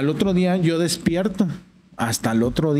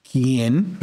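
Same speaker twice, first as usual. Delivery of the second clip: feigned-innocent cynical question, rising at the end. Retold or imitated. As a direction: play dumb cynically, with a laugh close by. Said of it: "yo todavía cínicamente le digo". (con cinismo, haciéndose el inocente)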